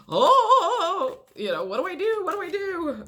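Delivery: a high, loud opening oh, then a thin what do I do; freaked out. Retold or imitated. The client who finally heard yes, flustered and anxious. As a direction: sound thrown by good news. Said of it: "kind of going".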